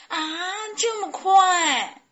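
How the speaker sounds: high, loud, drawn-out whine of protest. A narrator's reading. Chicks objecting that stories end, loud protest. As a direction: cry out in dismay like a small chick. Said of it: "小鸡们抗议道".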